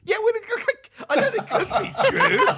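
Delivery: high, loud protest through laughter; exasperated squeal at a useless answer; laughing exasperation. Real, not acted. Quiz host to a contestant who won't commit, laughing exasperation.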